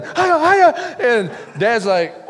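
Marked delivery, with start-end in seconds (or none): 0.0-1.0 high, laughing frantic cries, slowing at the end; a kid yelping while a fish drags him; panicked excitement. Retold or imitated. Boy still fighting the fish.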